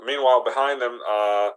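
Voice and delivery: ordinary talk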